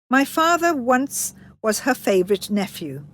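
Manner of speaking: plain reading voice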